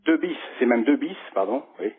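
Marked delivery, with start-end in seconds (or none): none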